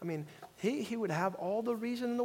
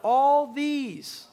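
louder, higher, drawn out for the congregation to join; call and response; 6 semitones higher, 7 dB louder, much slower than usual. Shown as a demonstration. Pastor leads the congregation, loud call and response. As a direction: lead the church in saying it together. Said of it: "say it with me again".

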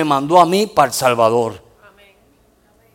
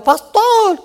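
much higher desperate mock call; imitated yell; 4 dB louder than usual. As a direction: cry out the name in alarm. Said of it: "Y ella gritaba".

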